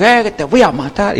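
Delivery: amenaza imitada, tono bastante más agudo; grito de pelea imitado. Retold or imitated. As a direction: imita un grito callejero sin gritar de verdad. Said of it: "se escucha gritos". (grito de pelea callejera fingido)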